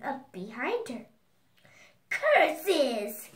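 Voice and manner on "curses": loud storybook exclamation of frustration; witch's cry. A narrator's reading. Baba Yaga blocked by the mountain, loud cry. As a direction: burst out loudly as the thwarted witch. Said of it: "cried Baba Yaga".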